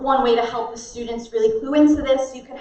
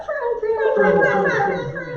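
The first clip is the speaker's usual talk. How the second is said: very high, squeezed little voice; tiny pinched prune voice; 12 semitones higher than usual. Shown as a demonstration. Drama teacher warms up the mouth, tiny high voice. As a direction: scrunch it small and squeak out the prunes. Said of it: "make your face very small".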